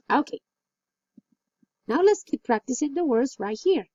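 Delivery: usual speaking voice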